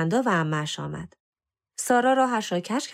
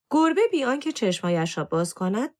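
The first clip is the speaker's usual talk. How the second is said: usual speaking voice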